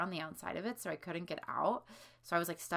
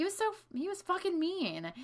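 indignant; 13 semitones higher, 3 dB louder than usual. Real, not acted. Venting about babysitter.

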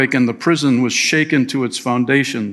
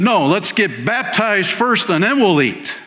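eager jailer; 7 semitones higher than usual. Retold or imitated. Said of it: "He said".

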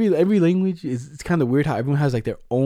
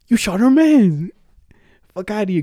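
outraged teammates; 6 semitones higher, 5 dB louder than usual. Retold or imitated. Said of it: "everyone's there like".

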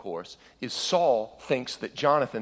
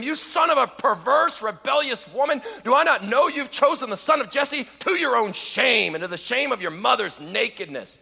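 Saul's furious outburst; 13 semitones higher, 5 dB louder than usual. Acted. King Saul raging at his son Jonathan, a furious high shout. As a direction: spit the insult out in a rage. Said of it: "Saul's anger was kindled against Jonathan".

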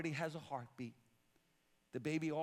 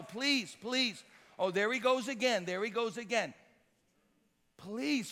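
raised pulpit voice, stretching the key words; insistent preaching; 6 semitones higher, 6 dB louder than usual. Real, not acted. Preacher pressing the congregation on what the Bible says, insistent preaching.

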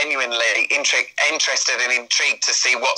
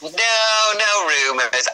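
coy denial; 8 semitones higher, much slower than usual. Pretend. Coy denial about crossover rumours.

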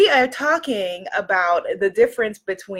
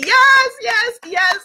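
high, loud ecstatic yes; overjoyed cry of yes; joyful yes; 10 semitones higher, 6 dB louder, slower than usual. Real, not acted. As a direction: burst out in delighted affirmation. Joyful affirmation to the audience.

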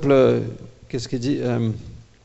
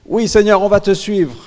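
high, loud, fervent yes to the Lord; eager crowd vow; 6 semitones higher, 6 dB louder than usual. Acted. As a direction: cry it out like a whole crowd swearing loyalty. Acting the people's eager vow.

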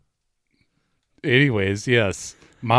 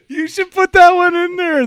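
laughing out the words, high-pitched; cracking up mid-sentence; 8 dB louder than usual. Real, not acted. Cracking up at egg dare.

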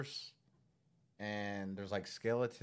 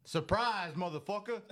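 loud, higher-pitched meme quote; mock-menacing gotcha; catchphrase imitation; 7 semitones higher, 7 dB louder than usual. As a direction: punch out the catchphrase like a famous TV line. Quoting a famous show catchphrase.

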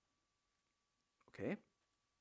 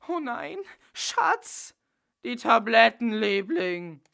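very high, pleading whine; hammed-up high whimper; pathetic whimper; 7 semitones higher, 10 dB louder, slower than usual. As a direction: whine for sympathy from your darling. Dracula whimpering to Bella.

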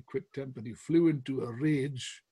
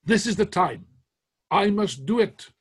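firm, raised, determined declaration; Cromwell's resolve; 6 semitones higher, 9 dB louder than usual. Retold or imitated. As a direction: announce the decision with conviction. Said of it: "and said to Major Harrison".

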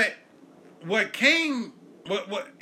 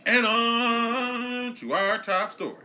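high, loud, very slow announcer voice; mock news anchor; 9 semitones higher, 5 dB louder, much slower than usual. Host introducing the top story, mock announcer. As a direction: draw it out like a TV anchor.

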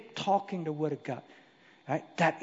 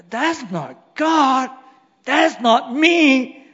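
God's rebuke; 8 semitones higher, 12 dB louder, much slower than usual. Acted.

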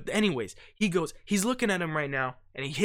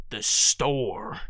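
"The store" lower and falling, certain; confident answer; 6 semitones lower than usual. Shown as a demonstration. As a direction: answer by just repeating it. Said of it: "Just repeat yourself".

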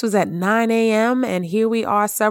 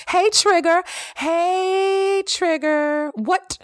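high, coaxing call to the trigger; pitched-up friendly greeting to her trigger; 7 semitones higher than usual. Pretend. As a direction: sing out as if greeting an old acquaintance.

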